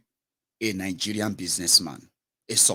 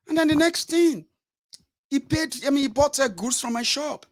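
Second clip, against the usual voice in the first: much higher, aggrieved shopkeeper telling it; businessman. Retold or imitated. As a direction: tell it high-pitched and aggrieved. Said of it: "businessman recounts".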